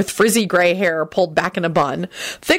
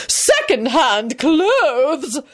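high, very slow disdain; shrill it out scornfully; drawn-out, high-pitched outrage; shrill contempt; 8 semitones higher, much slower than usual. A narrator's reading. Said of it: "Caroline shrilled".

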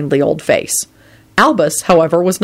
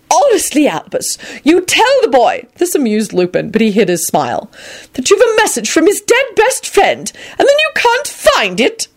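put-on high, scolding elderly lady; exasperated old woman; 12 semitones higher than usual. A narrator's reading. McGonagall scolding Dumbledore.

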